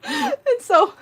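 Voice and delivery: laughing hard. Real, not acted. Telling the cat story, cracking up for real. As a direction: barely get it out for laughing. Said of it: "I'm laughing so hard".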